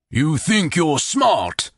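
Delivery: slow, drawn-out menacing growl; wounded chieftain growling; growled sneer. A narrator's reading. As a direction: threaten the man who trapped him. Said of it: "the Reese growled".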